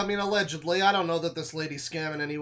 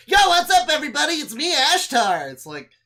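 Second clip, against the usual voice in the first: high, loud hammed-up spirit voice; mock alien channeler; 9 semitones higher, 7 dB louder than usual. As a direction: play a cheesy channeled space being. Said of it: "she closes her eyes two seconds later".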